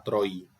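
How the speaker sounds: "troi" said as one syllable, the i like a y. Shown as a demonstration. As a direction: say "troi" with the i gliding. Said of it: "Como si fuera acá como una J". ("troi" con la i como una J)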